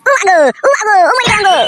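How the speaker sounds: high-pitched yelps of pain; pained yelling. Acted. Husband attacked by angry wife, yelling in pain. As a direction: cry out for mother in pain.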